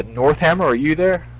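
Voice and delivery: ordinary delivery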